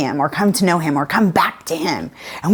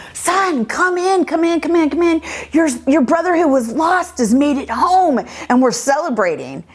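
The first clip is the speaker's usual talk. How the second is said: eager father; 7 semitones higher than usual. Retold or imitated. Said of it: "the father hears about it and he goes out to talk".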